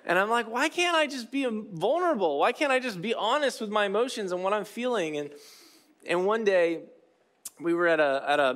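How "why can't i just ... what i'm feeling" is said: high-pitched, exasperated inner voice; self-frustration. Acted. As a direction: plead with yourself in mock exasperation. Voicing his frustration with himself.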